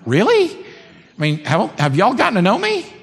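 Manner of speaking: high, loud incredulous "really"; mock disbelief. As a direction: act shocked they'd think that.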